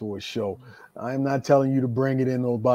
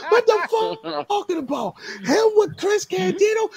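outraged, very high-pitched yelling; retold screaming objection, voice way up; going nuts. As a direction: imitate a promoter flatly refusing in disbelief. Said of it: "He's going nuts".

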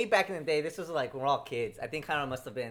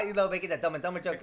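ordinary delivery

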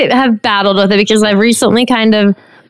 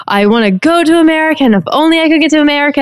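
higher, faster, mock-eager wishing voice; eager immigrant; 7 semitones higher, faster than usual. Host quoting hopeful immigrants, lightly mock-eager. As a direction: toss off an eager dreamer's plea, quick and high.

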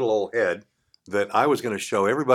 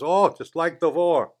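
put-on slow, higher line in a light German accent; German doctor's accent; 6 semitones higher, slower than usual. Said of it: "he had a very German accent".

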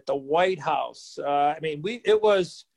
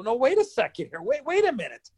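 high, rattled protest, retold; losing it; 7 semitones higher than usual. Rookie refused entry by the usher, losing it. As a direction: pitch it up as if panicking. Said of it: "I've lost it now".

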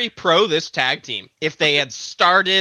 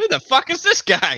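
shocked; 13 semitones higher than usual. Pretend. Imagined scared wrestling fan.

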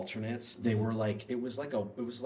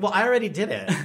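higher and louder, playfully defiant; high, loud mock-breezy confession; cheeky reply; 11 semitones higher, 10 dB louder, faster than usual. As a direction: toss it off loud and high, deed already done. Defiant reply to worried parents.

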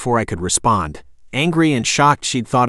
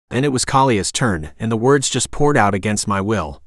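ordinary delivery, nothing marked

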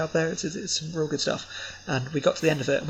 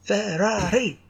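put-on high, excited Italian-kid cry of Ferrari; kid's Ferrari shout; 6 semitones higher, 3 dB louder, much slower than usual. Imitating the kid from the trailer, shouting Ferrari. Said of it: "that's what that kid says".

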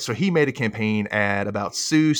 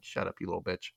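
low, soft grumble under the breath; muttered aside; 5 semitones lower, 12 dB quieter, faster than usual. Acted. Kurt silently answering Rachel's speech, muttered low. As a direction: mutter it privately, pitch dropped. Acts out Kurt's muttered inner reaction.

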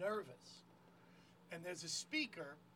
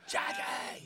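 callback crowd yell; mock crowd shout; 5 dB louder than usual. Comic reprising the crowd's chant for the host, mock shout. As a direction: holler it like the waiting audience.

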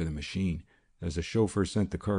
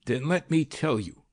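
raised high, furious roar; 7 semitones higher than usual. A narrator's reading. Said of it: "roared the man".

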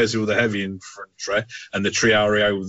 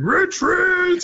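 high far-off mock yell of retreat; game soldier yelling retreat. Mimicking game soldiers for a friend, high mock yell. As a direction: squeal it like a panicking soldier. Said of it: "you just hear some guys going".

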